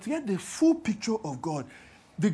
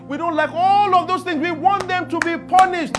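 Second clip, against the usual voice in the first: louder, higher rally preaching; preaching at full force; 7 semitones higher, 8 dB louder than usual. Acted. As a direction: rouse the congregation on justice.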